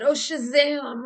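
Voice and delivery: sudden loud "Oh, Shazam" blurted slow; mock tic outburst. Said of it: "I'm just making up something now".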